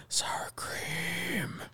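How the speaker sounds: creepy unvoiced whisper; horror whisper. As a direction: whisper like a jump scare. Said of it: "horror film where all the jump scares".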